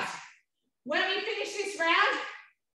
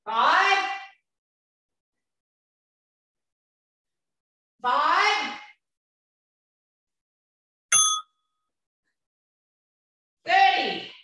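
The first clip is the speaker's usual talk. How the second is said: calling out; 3 semitones higher, 5 dB louder, much slower than usual. Real, not acted. Calling out reps mid-workout.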